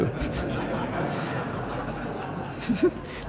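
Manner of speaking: high breathy laugh at his own joke; real laugh. Real, not acted. Lecturer laughing at his own joke to the audience. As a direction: laugh openly at the punchline.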